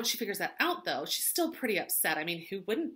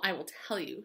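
ordinary talk

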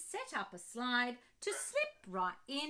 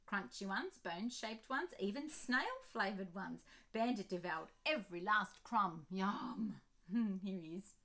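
plain reading voice

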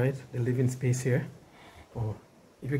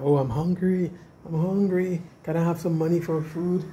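higher, whiny imitation of someone begging for food money; mock pleading beggar; 5 semitones higher than usual. Vlogger mocking neighbours who knock asking for money, whiny pleading. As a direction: mimic a neighbour pleading hunger, half-joking. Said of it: "no one is coming to tell me that".

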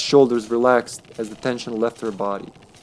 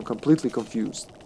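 normal talking voice

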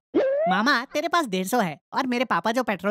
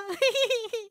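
relieved laugh; 9 semitones higher, 3 dB quieter than usual. Real, not acted. Laughs after escape.

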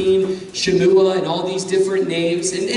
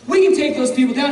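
excited boast; 5 semitones higher, faster than usual. Acted. Repeat of Caleb's boast.

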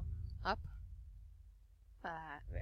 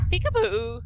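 high squeaky peekaboo, much higher; playful peekaboo; 11 semitones higher, 4 dB louder than usual. Real, not acted. Gamer surprising the hidden girl with a high playful peekaboo. Finds Anne hiding in chapel.

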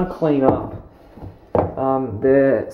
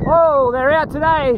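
alarmed; 11 semitones higher, 3 dB louder, slower than usual. Real, not acted. Spotting swooping magpies on ride.

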